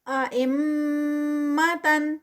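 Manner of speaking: ائمتن with ghunna on the میم, slowly; tajweed recitation. Shown as a demonstration. Teacher models ائمتن for students. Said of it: "میم کا غنہ".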